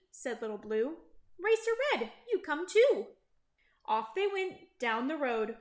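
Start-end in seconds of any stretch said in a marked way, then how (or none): 1.4-3.1 high, lifted friendly call; calling out invitation. A narrator's reading. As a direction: call a friend to come along. Truck calls out an invitation.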